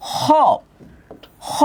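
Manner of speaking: "খ" as a raspy back-throat fricative, "kho"; careful letter sound. Shown as a demonstration. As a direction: scrape air at the back of the mouth for "খ". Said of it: "খয়ের উচ্চারণটা একটু খেয়াল করবেন".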